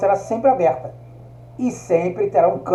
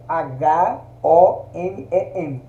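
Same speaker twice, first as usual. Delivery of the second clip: H O M E M spelled letter by letter, very slowly; very slow spelling to show the silent H; much slower than usual. Shown as a demonstration. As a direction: dwell on each letter of H O M E M. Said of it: "eu escrevo".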